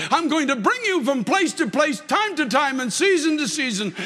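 impassioned revival preacher, voice straining high; preaching at full force. Acted. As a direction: preach it like you're calling out to a lost child. Preaches with rising fervour.

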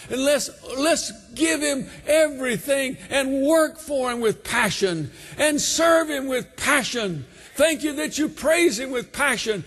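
pulpit climax, stretching out every word; preaching at full force. Acted. Sermon climax, shouting.

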